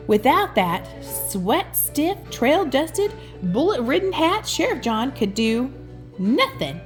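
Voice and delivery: ordinary delivery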